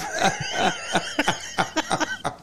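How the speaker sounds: helpless, high laughing fit; real laughter. Real, not acted. Host literally tickling himself over the recordings, helpless laughter. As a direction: crack up at your own joke. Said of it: "I'm laughing at myself".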